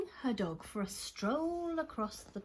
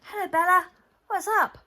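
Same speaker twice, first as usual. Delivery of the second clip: high, loud, bouncy friendly greeting; cheery Ben; 7 semitones higher, 9 dB louder, faster than usual. Acted. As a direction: call out brightly like a chirpy kid. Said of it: "said Ben".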